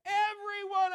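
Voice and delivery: Everyone said high and loud; stress on Everyone. Real, not acted. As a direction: lift the word Everyone. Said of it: "I love that word".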